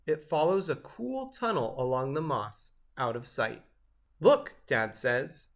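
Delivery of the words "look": high, loud, eager call; Dad points out the tracks eagerly; excited look. A narrator's reading.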